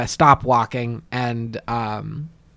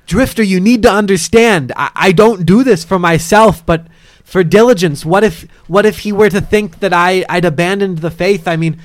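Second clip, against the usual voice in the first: raised, louder in-character appeal; insistent, high-pitched protest; urgent pleading; 7 semitones higher, 9 dB louder than usual. Acted. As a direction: plead your case to a companion. [name] pleads to keep his helmet.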